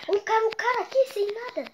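urgent shout spotting an enemy; excited callout. Real, not acted. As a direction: call it out loud and high. (grito animado avisando do inimigo)

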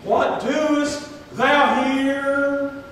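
God confronting the hiding prophet, loud and slow; God calling Elijah. Retold or imitated. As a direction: stretch out each word like a voice from heaven. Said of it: "And God comes up to him again".

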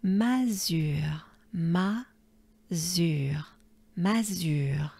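Mazure whispered with the French u and r. Shown as a demonstration. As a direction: whisper mazure, lips round on u, r breathed. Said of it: "the French R".

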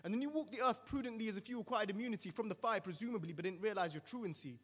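deafening angel voice. Acted. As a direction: hammer out each charge. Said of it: "responds in a deafening tone".

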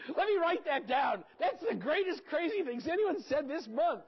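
high, loud, delighted mock glee; hammed-up delight; mock excited. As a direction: play thrilled at the quote. Mock excited note taking.

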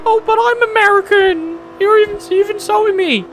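high, mocking whiny American voice; squeaky mock outrage from an American viewer; mock offended American. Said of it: "before you say".

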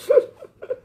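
sudden high-pitched burst of laughter. Real, not acted. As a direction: crack up in a squeaky laugh. Laughs at commenter. (bật cười the thé)

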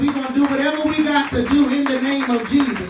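rising preacher's call, loud and high; preaching. Real, not acted. Cousin rallying the funeral crowd, preaching loud. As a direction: stir the mourners up like a preacher.

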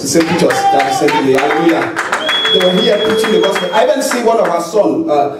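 ordinary delivery

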